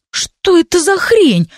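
high, drawn-out indignant protest; outraged and high-pitched; indignant outburst. A narrator's reading. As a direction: protest in a high voice, stretching the words. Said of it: "возмутился Стайль".

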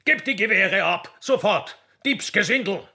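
high, pompous official scolding the poachers; indignant accusation. Acted. Gamekeeper berating poachers, high and indignant. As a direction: thunder out the charge like a puffed-up gamekeeper.

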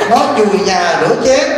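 ordinary delivery

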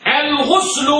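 "الغسل" as al-ghuslu, pitched high; ضم الغين. Shown as a demonstration. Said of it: "بالضم". ("الغسل" بضم الغين وبنبرة عالية)

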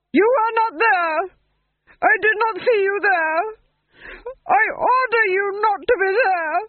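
high, wailing panicked denial; hysterical duke. Acted. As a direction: unravel into a sobbing rant.

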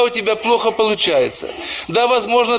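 ordinary talk, nothing marked

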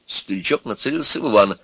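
plain reading voice

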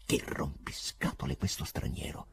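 whispered irritated thought; angry whisper. A narrator's reading. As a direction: hiss the annoyance under the breath. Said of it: "pensava arrabbiato".

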